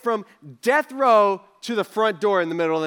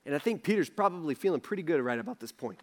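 normal talking voice

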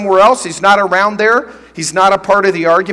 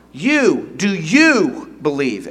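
"You" set apart and leaned on, then a slow "do you believe?"; a preacher pressing the word "You" home; double emphasis on you; 4 semitones lower, much slower than usual. Shown as a demonstration. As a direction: lean hard on "You", pause, then ask slowly. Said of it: "which means it has a double emphasis".

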